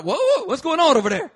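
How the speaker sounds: high loud alarmed laughing exclaim; startled crowd. Retold or imitated. Church members reacting to the scream, loud high alarm. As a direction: act out the startled congregation.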